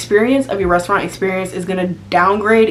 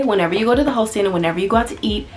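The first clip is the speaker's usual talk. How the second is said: ordinary delivery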